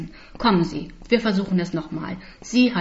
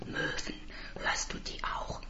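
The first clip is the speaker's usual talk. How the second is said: tonlos, fragend gehaucht; flüstere fragend; geflüsterte Frage; 14 dB quieter than usual. Acted. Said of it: "Frage ich". (flüsternde Frage)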